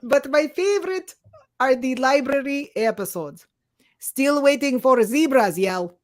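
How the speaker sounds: cartoonish Russian accent, like Gru; awful Russian accent. Reader struggles through fan review, badly faked Russian accent. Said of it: "Oh, it's getting Gru".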